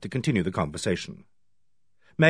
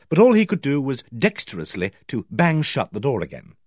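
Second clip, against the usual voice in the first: ordinary delivery, nothing marked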